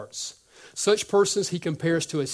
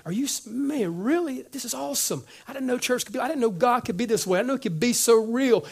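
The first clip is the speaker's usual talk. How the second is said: put-on higher, amazed young voice; amazed newcomer; 7 semitones higher than usual. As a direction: gush with disbelief. Excited new believer voiced.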